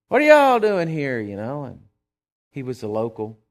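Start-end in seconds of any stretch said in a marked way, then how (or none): 0.1-1.2 loud, high barroom challenge. Retold or imitated. Drunk local loudly challenging the preacher's table. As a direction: bark it across the table.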